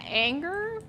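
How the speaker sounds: a touch of southern anger. Shown as a demonstration. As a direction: let the anger pull the southern accent out. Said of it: "anger actually brings out my accent".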